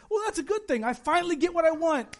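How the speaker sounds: high, delighted 'finally!' voice; naive hopeful listener. Pretend. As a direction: play the gullible believer, thrilled to get his wish. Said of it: "Now you might think".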